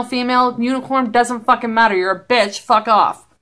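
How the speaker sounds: usual speaking voice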